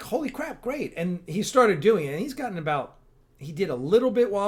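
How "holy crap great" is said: high, thrilled burst of delight; pitched-up happy cry of disbelief; excited exclaim. Retold or imitated. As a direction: yelp it out like you can't believe your luck. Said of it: "And we're like".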